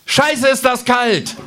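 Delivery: high, slow fresh kangaroo voice; andere Känguru-Stimme. Acted. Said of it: "das Känguru in einer ganz anderen Stimme zu sprechen". (hohe, langsame Känguru-Stimme)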